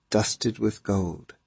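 ordinary delivery, nothing marked

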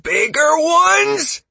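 slow, very high, loud horror; nearly unhinged. A narrator's reading. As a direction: screech it in rising disbelief. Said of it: "sounded on the verge of becoming unhinged".

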